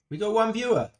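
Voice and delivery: suddenly high and loud, delighted; thrilled to see a viewer; excited. Real, not acted. First viewer arrives on stream.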